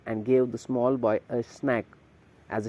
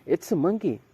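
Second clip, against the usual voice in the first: high, surprised cry; child spotting a monkey, astonished; astonished shout; 6 semitones higher than usual. A narrator's reading. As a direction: blurt it out amazed. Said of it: "shouted in astonishment".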